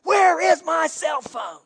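drawn-out, high mock scream. Mother imitated screaming at her kids for her phone, mock scream. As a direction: stretch out each word in a panicked mother's yell. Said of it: "Starts screaming at the kids".

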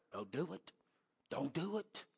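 soft, retold inner warning; hushed warning. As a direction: drop to a hush, as a quiet voice within. Retelling God's inner warning.

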